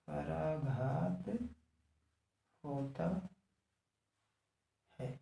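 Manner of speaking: soft, stretched words with long gaps. Real, not acted. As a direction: dictate it word by word, quietly. Repeats it word by word.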